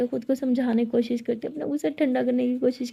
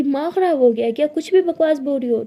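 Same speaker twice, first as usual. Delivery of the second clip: louder, fed-up scolding; exasperated anger; 2 semitones higher, 7 dB louder than usual. Acted. Siya loses patience with jealous girlfriend, loud exasperated scolding. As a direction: shout her down, fed up.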